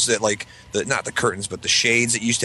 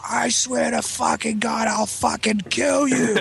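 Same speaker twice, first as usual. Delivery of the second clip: slow, much higher, seething threat; furious dad; 12 semitones higher, slower than usual. Retold or imitated. Father strangling his teenage son, slow, high, furious threat. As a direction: spit each word out like a raging father.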